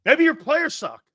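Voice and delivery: "Maybe your players suck" pitched high and punched; emphatic jab. Real, not acted. Sports host repeating his retort to the fans, emphatic.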